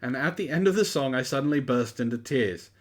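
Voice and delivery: usual speaking voice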